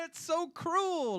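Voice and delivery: high, slow, hammed-up wail on cruel; mock-tragic cry, stretched out; mock lament. Joking about rationed toilet paper, a high hammed-up wail.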